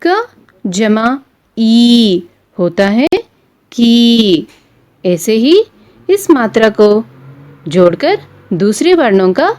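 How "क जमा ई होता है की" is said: क जमा ई होता है stretched very slowly, की landed louder; slow spelled-out sounding. Shown as a demonstration. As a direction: stretch each part of क जमा ई होता है for beginners, then hit की. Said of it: "उदाहरण देखिए".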